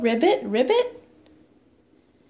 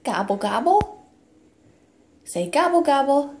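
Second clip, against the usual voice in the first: put-on playful sing-song toddler talk; reading to a toddler; 3 semitones higher than usual. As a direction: coax the toddler with animal sounds. Animal sounds game with toddler.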